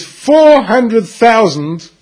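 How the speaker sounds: slow, drawn-out four hundred thousand; dragged-out outrage. Retold or imitated. Husband stretching the number out to his wife, slow and aggrieved. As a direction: stretch each word in disbelief.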